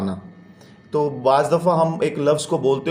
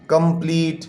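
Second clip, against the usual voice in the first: Complete split as कं-प्लीट; wrongly broken reading. Shown as a demonstration. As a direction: show how not to read complete, in two pieces. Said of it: "इस तरह नहीं है".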